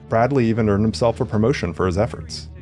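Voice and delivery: usual speaking voice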